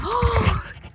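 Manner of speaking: high startled gasp; sudden awed gasp; sharp gasp. Real, not acted. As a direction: catch your breath at the sight. Sees condor fly over.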